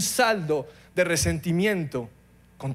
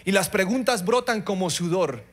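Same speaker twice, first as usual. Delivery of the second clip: ordinary talk, nothing marked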